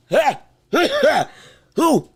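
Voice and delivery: normal talking voice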